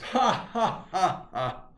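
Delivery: high, loud, staccato mock laugh; bully's laugh. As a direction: jeer with a put-on "ha ha".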